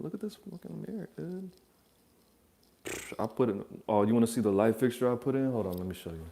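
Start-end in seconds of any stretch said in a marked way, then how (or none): 0.0-1.5 hushed awe. Real, not acted. Admiring mirror softly.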